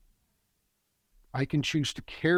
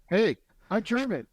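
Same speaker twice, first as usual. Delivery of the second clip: much higher, upbeat acted announcement; grateful pride; 9 semitones higher than usual. Coach modelling a grateful German, proud and upbeat. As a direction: announce it proudly, pitched right up. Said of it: "is to be grateful".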